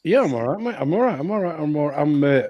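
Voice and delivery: normal talking voice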